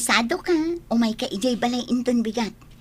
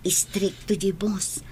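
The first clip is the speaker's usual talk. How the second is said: slow, hushed whisper; whispered aside; 4 semitones lower, 5 dB quieter, slower than usual. Acted. Jenaline confides to Margie about the strict boss in a slow whisper. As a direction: lean in and whisper it, slowly.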